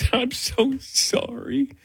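high, soft, drawn-out fake sobbing; mock crying. Pulled-over driver tearfully apologizing to police, mock crying. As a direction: blubber it slowly and softly, squeaking up high in pretend tears. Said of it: "Yeah, I'm already crying".

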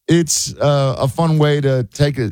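ordinary delivery

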